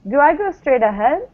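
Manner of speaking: "do I go straight ahead?" rising at the end; rising question. Shown as a demonstration. Teacher prompting Caio with a rising question. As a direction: model the rise for Caio. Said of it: "Um, Caio".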